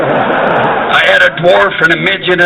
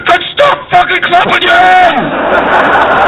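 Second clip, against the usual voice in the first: high, slow Scottish heckler's yell; small Scottish voice; much slower than usual. Acted. Scot at the back heckling [name], high Scottish yell. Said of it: "a small Scottish voice went".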